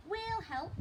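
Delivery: put-on high eager child; eager child Luke. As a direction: offer it keenly like a little boy. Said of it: "said Luke".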